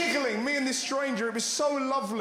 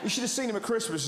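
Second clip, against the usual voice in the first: ordinary delivery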